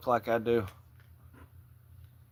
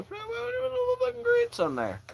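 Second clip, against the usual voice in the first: very high mock falsetto; play-acted falsetto aside; silly high voice; 4 dB quieter than usual. Acting out piling sides at buffet.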